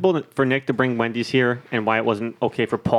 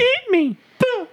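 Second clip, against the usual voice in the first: tiny, very high, slow squeak of a cookie man; Gingerbread Man impression; slower than usual. Podcaster imitates Gingy for his friends, squeaky high. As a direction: squeal it like a frightened little cookie. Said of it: "that's the gingerbread man".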